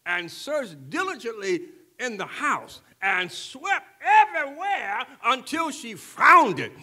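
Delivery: rising, loud preacher's cadence; preaching. Acted. Pastor to the congregation, building to a loud preaching climax. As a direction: preach the parable with full force. Preaching the lost coin parable.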